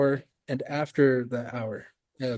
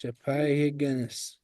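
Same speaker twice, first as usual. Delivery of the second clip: slow demo; 5 dB quieter, slower than usual. Shown as a demonstration. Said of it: "these ones are the minutes".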